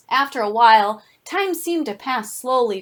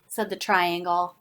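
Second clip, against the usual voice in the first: plain reading voice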